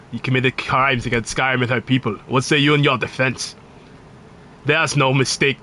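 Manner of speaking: lightly put-on Nordic guard accent; a Skyrim guard accent, stern. Streamer parodying a Skyrim guard, lightly put-on Nordic accent. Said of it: "the fucking accent".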